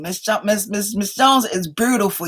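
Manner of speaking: normal talking voice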